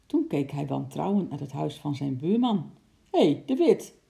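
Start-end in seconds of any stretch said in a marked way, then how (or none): 3.1-4.0 loud, high call over the fence; angry call. A narrator's reading. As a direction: summon the neighbour sharply. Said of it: "riep hij". (boos, luid geroepen)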